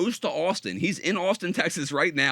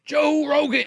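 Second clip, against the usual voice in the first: higher, louder yell of the name; mock shout; 13 semitones higher, 6 dB louder, slower than usual. Manic dad yelling the podcaster's name in his kitchen, mock shout. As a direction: belt out the name like a manic man. Liver King yelling at 3am.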